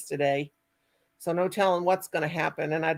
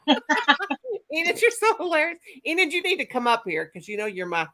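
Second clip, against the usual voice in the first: usual speaking voice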